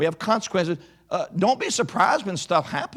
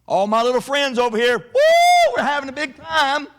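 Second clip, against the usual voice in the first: excited shout; 6 semitones higher, 5 dB louder than usual. Real, not acted. Cheering the kids.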